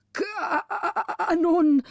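high, slow, faltering cry of a frightened councillor; faint stammer. A narrator's reading. Frightened councillor stammers at the cannons, faint and faltering. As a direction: stammer it out weakly, in terror. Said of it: "stotterte er mit matter Stimme hervor".

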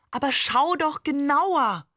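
insistent coaxing. A narrator's reading. Father insisting she look closer.

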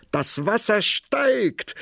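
high, slow, ringing call of alarm; excited cry. A narrator's reading. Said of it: "und rief".

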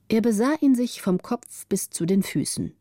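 usual speaking voice

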